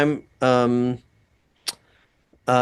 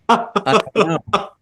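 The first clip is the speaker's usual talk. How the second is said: said through a laugh, higher and drawn out; 6 semitones higher, slower than usual. Real, not acted. Guest laughing at own pediatrician joke, laughing. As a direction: break up laughing on the words.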